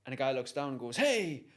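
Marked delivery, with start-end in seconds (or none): 1.0-1.5 loud, high mock hail; calling down. Passer-by looks down the hole, calling out. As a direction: act out calling to someone below.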